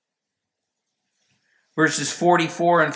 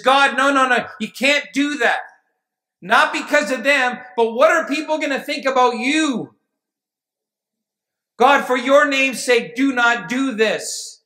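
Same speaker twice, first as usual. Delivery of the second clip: higher-pitched retold plea to God; protesting, imploring as Moses; urgent pleading; 7 semitones higher than usual.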